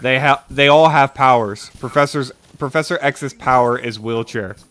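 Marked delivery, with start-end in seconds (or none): none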